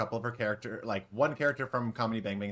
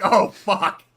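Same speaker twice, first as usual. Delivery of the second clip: caught-out dismay; 10 semitones higher, 15 dB louder than usual. Retold or imitated. Realizing she's been picked.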